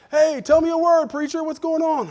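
high, loud mock call; loud hearty greeting. [name] calling out to the preacher at the gym, loud and high. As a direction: hail him across the gym.

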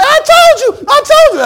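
very high, loud gloating shout; hammed-up victory cry; mock triumphant shout. Believers gloating at the reveal, high shout. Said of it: "And we say".

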